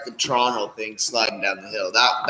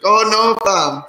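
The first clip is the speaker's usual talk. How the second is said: mock Toronto mans; 10 semitones higher, 6 dB louder, slower than usual. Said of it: "he's like".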